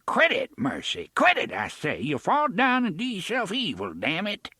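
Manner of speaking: put-on exasperated old farmer scolding his wife. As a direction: snap at her to stop struggling. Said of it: "He cursed and caught her arm".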